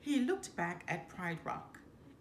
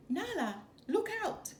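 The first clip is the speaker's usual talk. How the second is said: high urgent call to a friend; alarmed cry; 12 semitones higher than usual. A narrator's reading. Cub Simba to Nala, high and urgent. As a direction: warn her before the rhinos hit. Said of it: "Simba cried".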